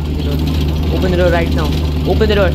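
loud, desperate yelling; panicked shouting. Real, not acted. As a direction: bang on it with your voice.

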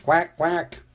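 high duck quacking. A narrator's reading. Narrator voices the duck.

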